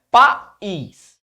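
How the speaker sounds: "País" as two separate beats, pa, ís; split into syllables. Shown as a demonstration. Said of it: "Tem essa separação silábica".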